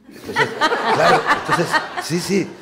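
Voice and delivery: breaking into loud laughter mid-sentence; real amused laughter while telling the story; laughing. Real, not acted. Recalling the radio listeners, laughing.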